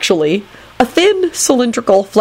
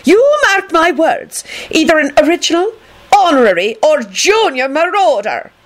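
wrathful; 10 semitones higher than usual. A narrator's reading. McGonagall accusing the marauders, wrathful. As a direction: stretch the accusation out in high-pitched wrath.

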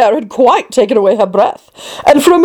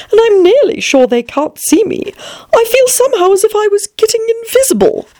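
high, lightly acted girl's voice; Alice; 11 semitones higher than usual. A narrator's reading. Alice musing that the chessmen can't see her, lightly voiced girl. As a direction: pitch it up for a storybook girl confiding. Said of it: "she went on as she put her head closer down".

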